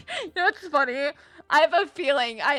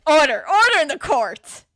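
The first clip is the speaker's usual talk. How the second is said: loud, higher stern judge bark; judge calling order; 4 semitones higher, 6 dB louder than usual. Acted. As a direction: pound the gavel and call the room to order. Judge calls the court to order.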